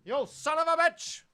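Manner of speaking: military aggression. Acted. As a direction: draw it out as a loud military order. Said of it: "military type of a-, aggression".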